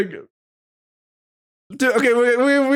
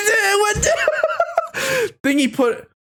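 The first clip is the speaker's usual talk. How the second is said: giggling through the words; can barely read for laughing; 11 semitones higher, much slower than usual. Real, not acted. Laughing mid-reading.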